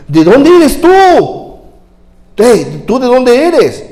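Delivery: high, loud, slow questioning as Pilate; Pilate demanding. Acted. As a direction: press the prisoner for an answer. (Pilato exigiendo, agudo y lento)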